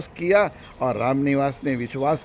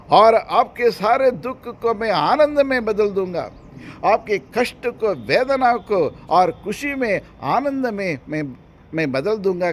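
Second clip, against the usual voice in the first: fervent proclamation; 9 semitones higher, 7 dB louder than usual. Acted. Preacher voicing Jesus' promise to the sorrowful, fervent proclamation. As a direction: deliver it as a divine promise.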